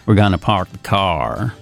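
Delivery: slow, melodic Virginia drawl; lilting, unhurried Southern US accent. Shown as a demonstration. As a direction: stretch it out like a Southerner. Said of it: "allora diventa più lenta, melodica".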